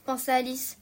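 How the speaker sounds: usual speaking voice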